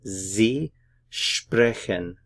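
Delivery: "Sie sprechen" very slowly, word by word, Sie as zee; drawn-out "Sie sprechen" for learners, buzzing the S of Sie; slow pronunciation demo. Shown as a demonstration. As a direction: separate "Sie" and "sprechen" with a clear pause. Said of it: "notice the pronunciation here".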